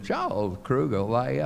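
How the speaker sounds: imitating Mrs. Kruger. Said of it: "Missus Kruger called and said".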